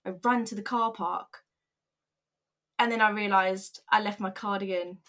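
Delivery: ordinary delivery, nothing marked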